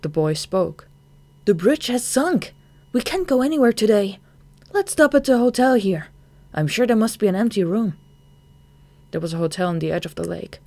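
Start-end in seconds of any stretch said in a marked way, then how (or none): 1.4-7.9 put-on higher, louder young boy; a boy calling out in a dream; boy's voice. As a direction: dream boy tells the narrator the bridge sank.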